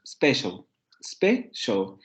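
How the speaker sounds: special in English, then broken into spe and shal; two syllables. Shown as a demonstration. Teacher contrasting English with Spanish, two-syllable special. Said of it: "en inglés solamente tendría dos".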